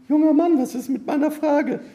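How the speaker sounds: very high falsetto woman's voice; loud-starting, high-pitched damsel's call; young woman calling. A narrator's reading. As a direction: call after him in a high put-on female voice. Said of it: "rief sie".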